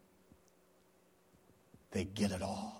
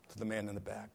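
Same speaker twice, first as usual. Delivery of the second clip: usual speaking voice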